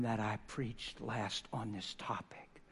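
hushed, slow whisper. Real, not acted. Pastor whispers to the church, slow and hushed. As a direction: slip the aside under the breath.

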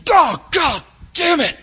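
loud, high, drawn-out angry shout; pained oath as the hammer slips; angry curse. Acted. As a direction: yell it like you just hit your thumb. Acted curse while hammering.

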